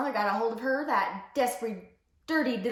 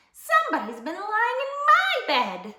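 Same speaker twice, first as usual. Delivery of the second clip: high, louder mama bear voice; middle-voiced mama bear; 12 semitones higher, 5 dB louder than usual. A narrator's reading. Mama bear finds her bed slept in, middle voice. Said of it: "said Mama Bear in her middle voice".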